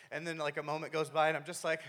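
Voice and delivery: ordinary talk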